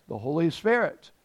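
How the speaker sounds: normal talking voice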